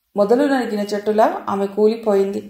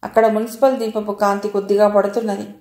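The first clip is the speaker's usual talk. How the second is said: ordinary delivery, nothing marked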